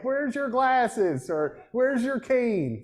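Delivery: slow, high, drawn-out taunt at the ref; mock heckling. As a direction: stretch it out like a heckler in the stands. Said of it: "what to yell at the refs".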